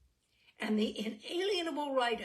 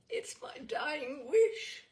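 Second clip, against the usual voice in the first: dying wish; 6 semitones higher, 6 dB louder, slower than usual. Acted. Dying mother's last plea.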